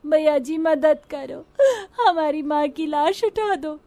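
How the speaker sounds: high crying plea; tearful begging. Acted. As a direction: beg strangers through sobs. Orphan begs passersby for help.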